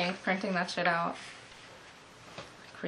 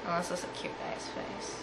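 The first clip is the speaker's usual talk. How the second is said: unvoiced, much softer cheeky whisper; whispered aside; 11 dB quieter than usual. Real, not acted. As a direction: let it slip under your breath.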